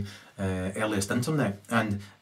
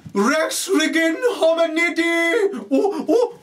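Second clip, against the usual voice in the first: much higher, slow, loud exaggerated ranting voice; Hitler impression; 5 dB louder, much slower than usual. Showing off his promised impression, high slow hammed-up rant. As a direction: ham it up as a shrieking dictator. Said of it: "I'm gonna do the best impression of Hitler".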